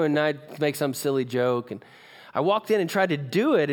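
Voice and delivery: ordinary delivery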